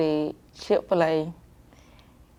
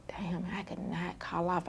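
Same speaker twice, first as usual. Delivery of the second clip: a much softer, voiceless, frustrated whisper; whispered frustration; 12 dB quieter than usual. Retold or imitated. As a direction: swear under your breath. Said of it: "I like".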